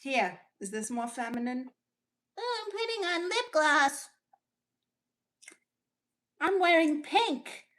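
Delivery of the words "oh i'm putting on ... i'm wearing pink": put-on sarcastic high girly voice; mock girly voice. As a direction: ham up a prissy feminine caricature. Mocking feminine voice.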